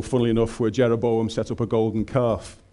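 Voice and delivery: usual speaking voice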